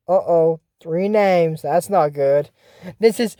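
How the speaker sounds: ordinary talk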